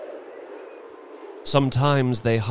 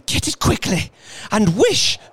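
high, breathless, frantic urging; panting; 13 semitones higher, 5 dB louder than usual. A narrator's reading. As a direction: pant out the order desperately. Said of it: "she panted".

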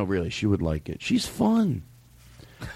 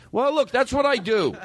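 ordinary talk

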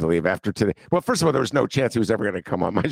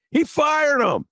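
high, indignant cry; outraged exclamation; slower than usual. Real, not acted. Guest telling co-host about fired workers, high indignant cry. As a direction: cry it out in disbelief. Indignant punchline of his story.

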